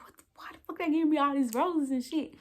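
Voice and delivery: high-pitched bewildered question; retold shock. Woman reliving being handed roses at the surprise, shocked. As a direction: voice climbs high in baffled disbelief. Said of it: "I'm shocked".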